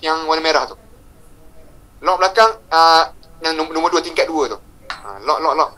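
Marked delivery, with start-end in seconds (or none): none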